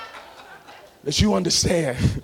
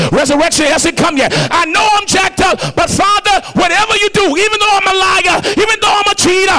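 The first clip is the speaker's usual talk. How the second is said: high, loud, half-sung preaching over the organ; shouted, singing sermon climax; full-force preaching; 11 semitones higher, 8 dB louder than usual. Acted. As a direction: whoop it up like a revival preacher.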